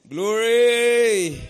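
ringing drawn-out acclamation; exultant shout. Real, not acted. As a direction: shout it out over the congregation, stretched long. Preacher leads congregation's confession.

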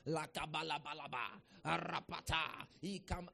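slow, mock praying in tongues; imitated ecstatic prayer language; imitating tongues. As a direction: chant drawn-out syllables like someone praying in tongues. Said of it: "they are speaking in tongues".

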